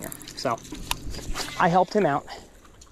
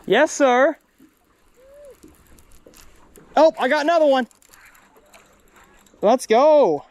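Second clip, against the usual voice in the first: excited cheering; 9 semitones higher, slower than usual. Real, not acted. Cheering while fishing.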